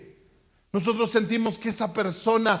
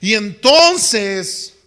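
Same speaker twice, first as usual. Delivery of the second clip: ordinary talk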